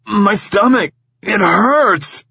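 high, loud groaning plea; groaning in pain. Acted. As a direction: moan it like your gut is torn. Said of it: "he groaned".

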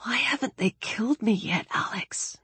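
high, hushed whisper; despairing whisper. Acted. Despairing Daniel asks Alex why he lives, whispered. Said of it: "he whispered".

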